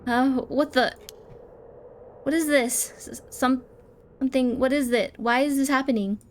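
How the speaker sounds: high, loud stammering disbelief; acted panic. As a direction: stumble over the words in alarm. Voices character's panic.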